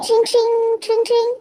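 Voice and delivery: high, singsong ringtone imitation; imitated phone ring. Shown as a demonstration. Narrator imitates the boss's phone ringing, high trill. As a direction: trill like an old telephone.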